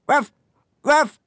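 sharp high imitated woofs; dog bark. A narrator's reading. Dog alerting at a snowy shape, barking. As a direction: bark like an eager little dog. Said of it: "aboya alors Flanelle".

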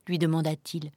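ordinary delivery

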